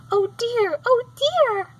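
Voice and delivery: high, loud cry of dismay. A narrator's reading. Dorothy crying out in dismay at the witch's feet. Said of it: "cried Dorothy".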